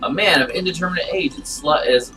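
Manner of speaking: usual speaking voice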